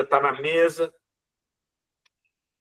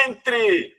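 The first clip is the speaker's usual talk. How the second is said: called again; 8 semitones higher than usual. Acted. Voice calling again.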